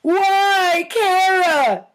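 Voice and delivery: high, loud anguished cry; yell. A narrator's reading. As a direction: wail for the lost hamster. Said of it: "yelled Penny".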